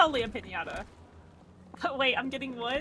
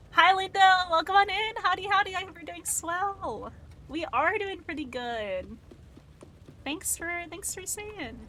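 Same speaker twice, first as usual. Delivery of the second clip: cheery host; 5 semitones higher than usual. Acted. Greeting a viewer named Linda.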